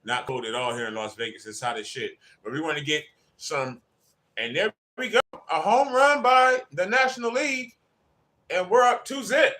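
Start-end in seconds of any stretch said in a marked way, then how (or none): 4.5-9.5 loud, high, real excitement; excited home-run call. Real, not acted. Fan announcing a National League home run, excited call. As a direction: raise the pitch and belt out the score.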